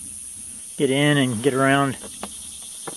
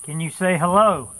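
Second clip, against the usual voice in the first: raised, lilting call into the woods; 4 semitones higher than usual. Real, not acted. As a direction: call out gently to someone unseen, pitched up. Calling out to unseen woodland friends.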